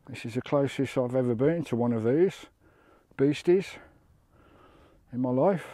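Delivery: excited. Real, not acted. Close to a seal on rocks.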